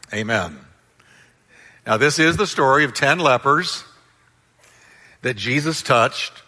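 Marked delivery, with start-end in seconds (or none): none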